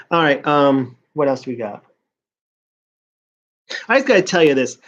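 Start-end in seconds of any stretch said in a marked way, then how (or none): none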